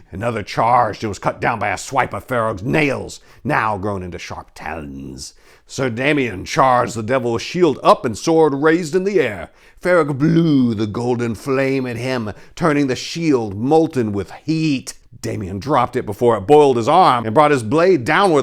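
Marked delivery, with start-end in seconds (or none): none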